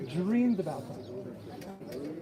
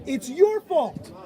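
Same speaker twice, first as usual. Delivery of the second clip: slow, pointed, louder; slow loud accusation; 5 semitones higher, 5 dB louder, slower than usual. Retold or imitated. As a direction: point the finger and land each word. Jester accuses the rebbe.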